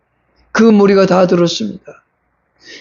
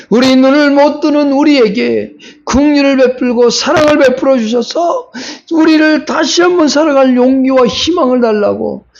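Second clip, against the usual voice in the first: higher, rising preacher's plea; fervent preaching; 5 semitones higher than usual. Acted. Pastor voicing the blind men's plea, fervent. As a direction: lift it like a cry to heaven.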